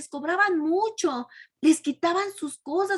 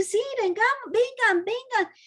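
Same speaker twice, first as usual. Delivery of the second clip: higher, calling out to others; eager crowd; 7 semitones higher than usual. Retold or imitated. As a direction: call the people to come join. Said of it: "a decir". (la gente llama a los demás, emocionada)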